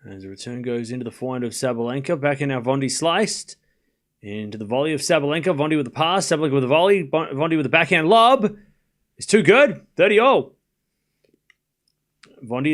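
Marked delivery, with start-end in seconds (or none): 0.1-10.5 live tennis play-by-play. Real, not acted. Commentator calls a long rally for viewers, building excitement. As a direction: ride the rally up to an excited finish.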